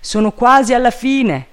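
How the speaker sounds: high, loud announcement; calling down. A narrator's reading. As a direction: report loudly from the treetop. Servant calls down from branch.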